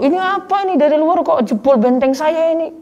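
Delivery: high, offended outburst; indignant voice. Retold or imitated. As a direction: play the affronted one who won't accept it. Acts out offended resister.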